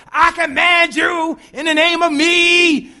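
high, loud, slow mock command; slow booming mock rebuke; mock commanding shout. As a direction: bellow it like a showy exorcist. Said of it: "Jesus said".

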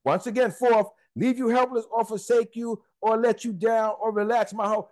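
ordinary talk

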